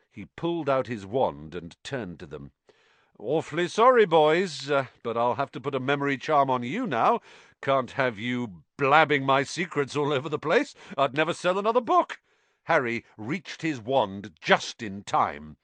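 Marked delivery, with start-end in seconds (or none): 3.2-12.2 Lockhart. Acted. Lockhart threatens memory charm.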